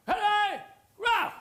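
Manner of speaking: troops' charging hooray; battle cry. Retold or imitated. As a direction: yell the charge.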